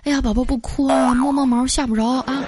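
acted baby-soothing voice; soothing a child. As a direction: hush a crying toddler tenderly. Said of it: "我赶紧跑过去把孩子抱起来".

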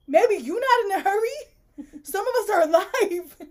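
high, loud mock outrage; indignant comeback. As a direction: tell him off, hammed-up. Ranting back at immortal character.

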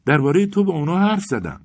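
a sudden high, loud outburst; sudden shout. A narrator's reading. Vasya suddenly remembers and tells Arkady, shouting. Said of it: "فریادی کشید و گفت".

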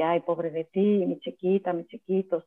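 mock a mother who spoils her kids; mock overindulgent pity. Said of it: "solaparlos todo el tiempo".

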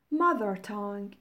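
normal talking voice

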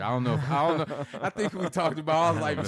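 giggling through the words; laughing. Real, not acted. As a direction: laugh while you talk. Laughing about rival coach.

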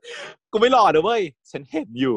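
high, laughing replay of the line; giggly high-pitched retelling of the quote; amused re-enactment. Retold or imitated.

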